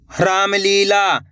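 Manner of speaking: Ramlila stretched slowly, syllable by syllable; slow reading. Shown as a demonstration. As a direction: read Ramlila slowly for the class. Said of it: "कैसे पढ़ेंगे?". (रामलीला धीरे धीरे)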